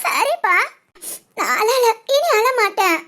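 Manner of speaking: trying to stop crying; tearful. Acted. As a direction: promise through the last of your tears. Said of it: "நீ இப்படி அழுதுகிட்டு". (அழுகையை அடக்கிக்கொண்டு)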